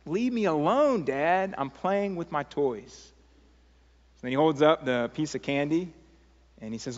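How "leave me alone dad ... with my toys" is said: high-pitched, drawn-out protest; whiny child. Acted. Son refusing to leave his toys, whiny protest. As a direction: push Dad away like a put-upon kid.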